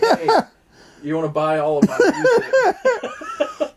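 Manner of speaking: high, loud, slow laughing ask; mock phone pitch. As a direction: ham up a cheeky sales call. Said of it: "ring up Capitol and say".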